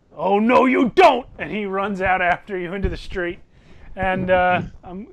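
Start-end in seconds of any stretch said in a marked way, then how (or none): 0.1-1.2 loud, raised indignant shout; fat guy shouting. Acted. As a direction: bellow it to stop someone escaping. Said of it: "the fat guy here says".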